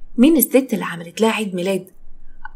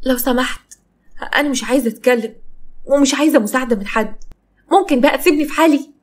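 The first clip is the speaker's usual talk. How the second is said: higher, rising plea to be left alone; upset refusal; 5 semitones higher than usual. Acted. Salma wanting her brother to leave her alone, upset. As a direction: push him away, pitch climbing at the end. Sister fends off brother in quarrel.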